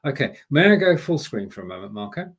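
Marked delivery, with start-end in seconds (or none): none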